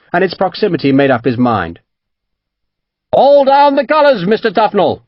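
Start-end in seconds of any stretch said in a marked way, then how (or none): 3.1-5.0 high, loud command to strike colours; shouted order. A narrator's reading. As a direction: bark the order across the deck. Captain orders surrender under fire.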